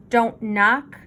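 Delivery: ordinary delivery, nothing marked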